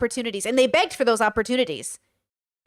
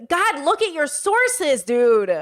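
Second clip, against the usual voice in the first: higher and slow, ridiculing; mocking; 4 semitones higher, 3 dB louder, much slower than usual. Real, not acted. Streamer ridiculing Mutahar over his sources, slow and mocking. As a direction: draw it out to mock him.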